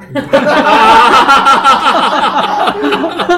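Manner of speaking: loud rising laugh; loud burst of laughter. Real, not acted. Laughs at Hakka joke.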